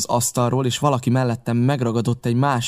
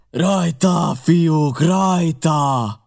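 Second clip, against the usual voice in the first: higher, drawn-out battle yell; thunderous roar; 5 semitones higher, 4 dB louder, much slower than usual. A narrator's reading. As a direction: roar the men on to attack. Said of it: "ordította mennydörgő hangon". (mennydörgő hangú ordítás)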